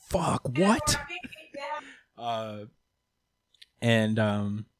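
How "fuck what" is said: sudden high yelp of a question; startled Itadori, much higher on what; surprised. Retold or imitated. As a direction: jump up in pitch, startled. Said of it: "Itadori's like".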